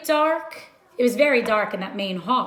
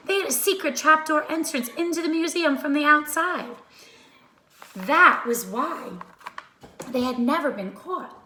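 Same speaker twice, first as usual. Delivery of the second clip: plain reading voice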